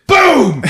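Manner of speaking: loud, high shouted boom; shotgun blast. Retold or imitated. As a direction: burst out with a sudden gunshot boom.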